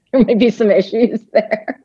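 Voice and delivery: laughing through the words. Real, not acted. Guest laughing at her colour-coded socks, laughing. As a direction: chuckle while admitting it.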